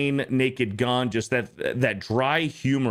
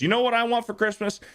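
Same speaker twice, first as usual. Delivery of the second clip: high, fast furious rant climbing higher; ranting Clark; 10 semitones higher than usual. Retold or imitated. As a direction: build the tirade up to a shriek. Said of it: "he goes on his rant".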